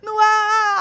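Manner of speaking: high, loud exclamation; shouted cry. Retold or imitated. Host on the tower shouting to the live audience. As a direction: belt it out.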